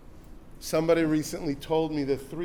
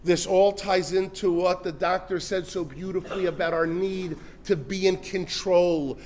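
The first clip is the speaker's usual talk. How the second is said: usual speaking voice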